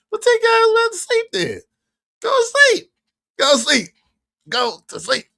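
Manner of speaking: high, loud scolding yell. Real, not acted. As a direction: shoo them off to bed. Yelling at viewer.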